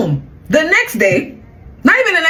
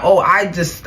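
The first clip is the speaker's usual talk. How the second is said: normal talking voice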